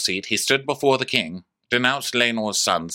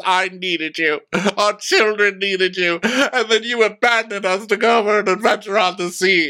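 angry rant building; 10 semitones higher, 4 dB louder than usual. Retold or imitated. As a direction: voice rising higher and louder.

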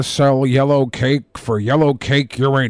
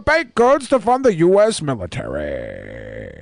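booming monster truck rally announcer; 2 semitones higher than usual. Retold or imitated. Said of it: "talks like he's an announcer at a fucking, uh, monster truck rally".

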